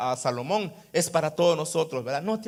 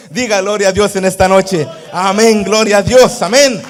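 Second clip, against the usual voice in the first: much louder amen and gloria; fervent shout; 13 dB louder than usual. Acted. Preacher asks the church to say glory to God, shouting. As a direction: rouse the congregation to praise.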